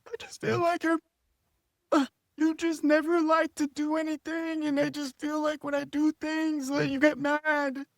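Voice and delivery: high-pitched imitation of girlfriend; mock girlfriend complaint. Mimics girlfriend complaining on call.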